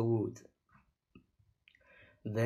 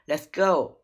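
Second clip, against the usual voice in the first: loud, raised urgent call; crying out; 4 semitones higher, 8 dB louder than usual. A narrator's reading. Girl urging her brother out of the storm, crying out. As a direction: cry out to get moving. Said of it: "cried Annie".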